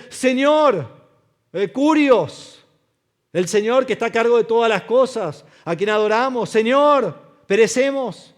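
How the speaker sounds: high, loud desperate prayer; pleading shout. Acted. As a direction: cry out to the Lord. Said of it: "los discípulos decían". (súplica a gritos al Señor)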